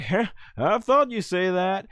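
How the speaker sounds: light imitation of a friend's reply; Goku. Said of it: "And Goku's like".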